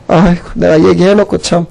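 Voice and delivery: normal talking voice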